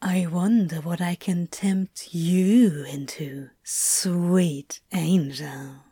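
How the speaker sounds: very slow, low whisper; soft hissing whisper. Acted. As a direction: hiss softly, tempting. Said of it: "hissing softly in his ear".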